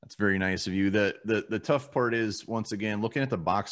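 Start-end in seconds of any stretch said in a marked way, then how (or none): none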